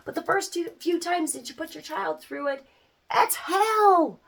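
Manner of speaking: ordinary talk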